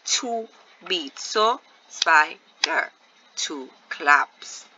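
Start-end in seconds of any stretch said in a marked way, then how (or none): 1.9-2.9 SPIDER slowly broken, SPI ... DER; two separate claps' worth of SPIDER, SPI and DER; split into syllables. Shown as a demonstration. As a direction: SPIDER sounded out with a gap after SPI. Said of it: "when I break it up".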